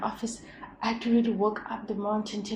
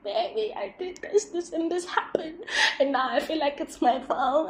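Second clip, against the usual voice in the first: whiny self; 10 semitones higher than usual. Pretend. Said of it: "gonna be like".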